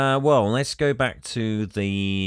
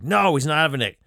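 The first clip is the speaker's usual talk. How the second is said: imitated indignant refusal, a bit higher; mock hissy fit; 5 semitones higher, 3 dB louder, faster than usual. Retelling how he can't deal with Mason getting anything, mock hissy fit. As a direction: put on a mild, louder outburst of protest. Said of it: "he throws a hissy fit".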